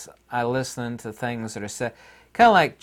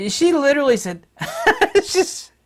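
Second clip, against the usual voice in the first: laughing; 14 semitones higher, 6 dB louder than usual. Real, not acted. Laughing before mocking the director.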